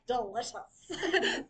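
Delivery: mock savoring. Mock reader savoring chocolate description.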